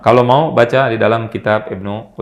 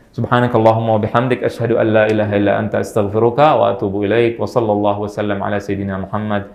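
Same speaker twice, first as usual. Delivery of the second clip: closing prayer; 4 semitones lower than usual. Real, not acted. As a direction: murmur the closing supplication.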